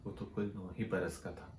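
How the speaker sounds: low, soft grieving recitation; hushed lament. Acted. Poet mourning a murdered nine-year-old child, hushed lament. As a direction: drop to a subdued, mournful question.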